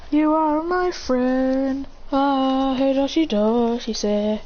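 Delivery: high, slow, drawn-out cry while falling; dramatic falling cry. Acted. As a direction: stretch it out like a hero falling backwards.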